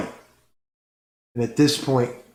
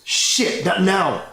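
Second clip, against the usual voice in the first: frustrated curse; 8 semitones higher, 3 dB louder, slower than usual. Acted. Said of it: "She goes".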